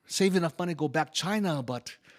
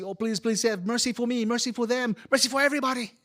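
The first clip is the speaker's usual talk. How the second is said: rising, high, louder plea for mercy; pleading prayer; 10 semitones higher, 4 dB louder, faster than usual. Acted. Immigrant begs Kuan Yin for mercy, rising and pleading. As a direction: beg the goddess, building. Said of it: "I pray to Kuan Yin".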